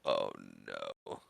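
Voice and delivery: very low, hushed dismay; heartbreak moment. Retold or imitated. Boy seeing ball clear the fence, hushed dread. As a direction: let it sink to a quiet, crushed murmur. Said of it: "Just that break moment of".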